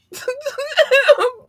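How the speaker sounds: high shrieking laughter; loud cackle. Real, not acted. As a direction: burst out laughing. Cracks up at fake bars line.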